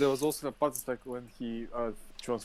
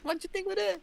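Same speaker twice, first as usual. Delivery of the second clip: much higher, put-on voice; high-voiced mimicry; faster than usual. Prostitute answering the worried priest, high mimicking voice. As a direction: squeak the question out in mimicry. Same line repeated in high voice.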